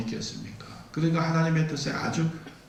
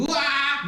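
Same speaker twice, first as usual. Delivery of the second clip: sudden high-pitched cry of pain; pained scream; 13 semitones higher, much slower than usual. Acted. Preacher acts Balaam's leg crushed against wall, high pained scream. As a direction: yelp as if a leg is being crushed. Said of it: "막 소리를 지릅니다".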